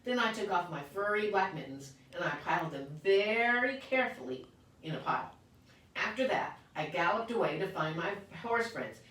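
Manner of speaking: ordinary delivery, nothing marked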